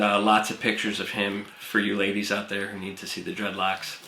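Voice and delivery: usual speaking voice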